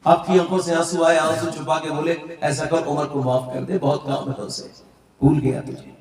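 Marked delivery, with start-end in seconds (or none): 2.4-5.9 low, soft plea holding back tears; tearful hushed plea. Acted. Umar to the widow, hushed and tearful. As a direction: beg quietly through hidden tears.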